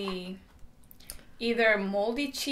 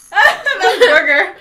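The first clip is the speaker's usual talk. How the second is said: laughing disgust; 9 semitones higher, 13 dB louder, much slower than usual. Real, not acted. Reacting to booger flavor.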